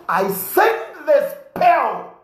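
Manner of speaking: slow, high, hammed-up incantation; mock spell casting. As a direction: cast it like a curse, drawn out.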